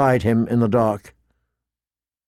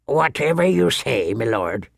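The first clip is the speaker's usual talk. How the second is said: unimpressed. A narrator's reading. Old man facing an angry young lord, unimpressed. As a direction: humour him, then let the title fall.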